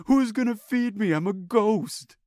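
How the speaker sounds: mock-tragic ghost whining for food; pleading ghost. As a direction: beg like a ghost being dumped. Voicing the ghost in a breakup.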